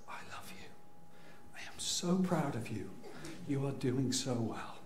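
hushed, soft, tender reassurance; suddenly serene mother. Retold or imitated. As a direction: imitate a new mother cooing, whisper into soft voice. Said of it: "my wife's emotional state and my emotional state switched".

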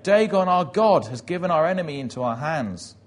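loud, high proclamation that eases off; crying out. Retold or imitated. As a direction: cry it out to a whole arena. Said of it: "another voice crying out".

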